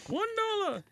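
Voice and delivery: game show contestant. Acted. Price is Right bid.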